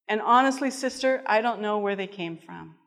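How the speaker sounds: ordinary delivery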